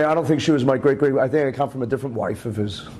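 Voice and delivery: ordinary delivery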